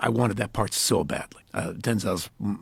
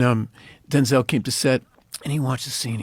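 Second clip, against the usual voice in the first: ordinary talk, nothing marked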